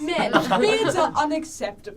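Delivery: loud, drawn-out mock proclamation; mock ranting co-host. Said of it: "Just Mond be like".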